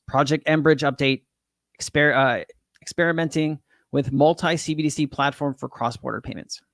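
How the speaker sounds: usual speaking voice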